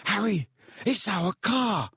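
high, elated calling out; breaking with relief. A narrator's reading. Ron spotting the family car, high relieved cry. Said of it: "his voice breaking with relief".

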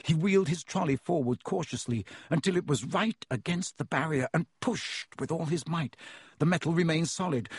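usual speaking voice

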